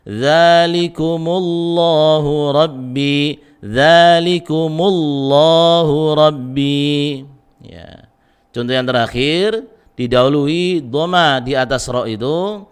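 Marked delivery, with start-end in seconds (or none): 0.1-7.3 slow melodic tajwid chant; Quran recitation. Shown as a demonstration. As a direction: chant the verse slowly with heavy lam.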